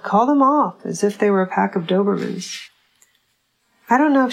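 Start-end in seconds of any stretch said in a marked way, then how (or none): 0.0-0.8 retold yell. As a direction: bark it out like a mother shooing dogs away. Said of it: "She yelled".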